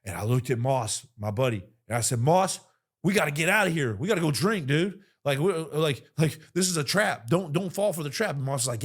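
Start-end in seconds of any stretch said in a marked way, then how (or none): 2.2-8.4 urgent shout. Retold or imitated. Urging buddy to flee.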